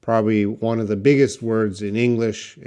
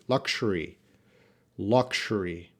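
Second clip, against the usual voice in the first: ordinary delivery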